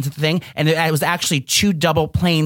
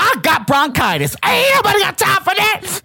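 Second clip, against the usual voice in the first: meme shout; 14 semitones higher than usual. Pretend. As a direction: belt out the viral catchphrase in a put-on voice. Meme quote shouted after joke.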